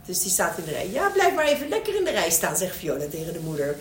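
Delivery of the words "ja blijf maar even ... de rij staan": high, loud sing-out telling someone to wait; pitched-up pretend queue voice; role-played bossy child. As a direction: playfully order them to stay in line. Child role-plays mother in queue.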